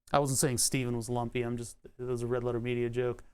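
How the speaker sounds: normal talking voice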